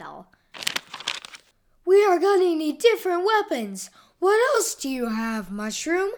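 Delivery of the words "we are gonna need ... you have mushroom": high, loud urgent call; Donut exclaiming. A narrator's reading. Donut asking Mushroom for weapons mid-battle, urgent exclamation. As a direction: exclaim it urgently over a fight. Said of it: "exclaimed Donut".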